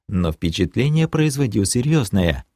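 ordinary delivery, nothing marked

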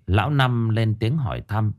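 ordinary delivery

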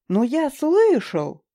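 loud, slow indignant protest; insist, offended and loud. A narrator's reading. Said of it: "возмущался тот".

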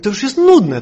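loud, high retold complaint; exasperated neighbour. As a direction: imitate a fed-up neighbour. (głośne, wysokie, zirytowane narzekanie)

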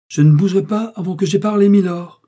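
high, loud refusal; defiant cry. A narrator's reading. Madame Duke defying the judge, crying out. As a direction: cry out and stand your ground. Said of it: "s'écria madame Duke".

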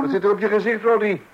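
high, loud shocked question; mother finds shoe polish on Roddy's face, shocked and high. Acted. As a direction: recoil at the sight of the child's face. Parent discovers shoe polish on the child.

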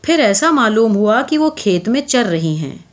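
usual speaking voice

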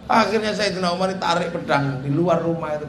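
ordinary talk

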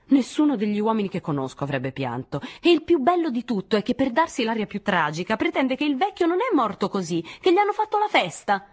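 heated, climbing to very high pitch; high-pitched derisive outburst; scornful tirade. A narrator's reading. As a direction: rant mockingly, building to a cry. Nadine continues her scornful tirade.

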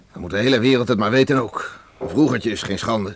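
indignant outburst. Acted. Father defiantly tells his son the whole village may know. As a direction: let it burst out, let the whole world hear. Father defends dead son's name.

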